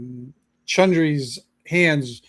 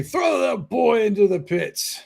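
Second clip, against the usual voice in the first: jeering crowd shout; 6 semitones higher than usual. Acted. Crowd member heckling the halfling boy, jeering shout. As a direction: yell it like a cruel onlooker in a crowd.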